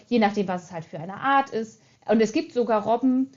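ordinary delivery

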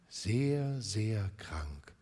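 whispered, sombre answer; grave whisper. Acted. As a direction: spirit whispering the sad truth.

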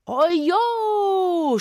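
high, loud cry; shout. A narrator's reading. Boy with a rattle yelling dramatically. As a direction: yell it out wildly. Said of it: "schrie er".

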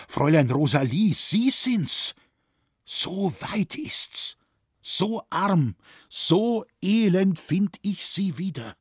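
high, anguished lament in broken phrases; pained outcry. A narrator's reading. Franz finds Rosalie poor and wretched, pained outcry. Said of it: "rief jetzt Franz mit schmerzlichem Tone".

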